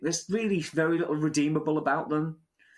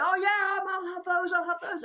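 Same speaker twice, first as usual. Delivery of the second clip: high, eager crowd voice; gullible buyer. Retold or imitated. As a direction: grab them like a hyped buyer. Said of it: "other people start going".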